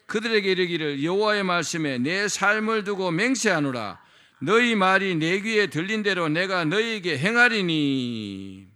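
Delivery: loud, slow solemn scripture voice; scripture reading. Acted. Pastor reading Numbers aloud to the congregation, solemn proclamation. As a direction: proclaim God's words with weight.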